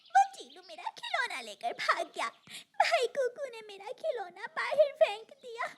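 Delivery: put-on high, whimpering child-bird voice; tearful telling. Injured little bird explaining to mother, tearful. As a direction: whimper out what happened.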